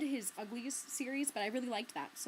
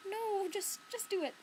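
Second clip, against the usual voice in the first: high mock plea; retold pleading; 10 semitones higher than usual. Patron urging librarian to give in, high insistent plea. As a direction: re-enact pressing her, voice pitched well up. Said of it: "And I was like".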